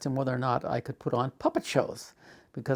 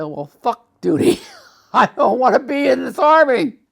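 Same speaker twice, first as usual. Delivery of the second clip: loud, high, laughing defiance; defiant outburst; 11 semitones higher, 9 dB louder than usual. Retold or imitated. Soldier to chaplain, rejecting duty, loud defiant shout. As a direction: fling it at him with a bitter laugh.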